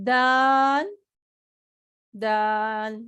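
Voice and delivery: دال said clearly, first one loud; Arabic daal. Shown as a demonstration. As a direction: model the letter daal. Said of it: "تو کیسے پڑھیں گے اسے؟". (دال صاف آواز میں)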